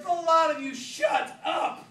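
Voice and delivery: loud, high shout. Acted. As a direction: bellow them into silence. Mick silencing everyone.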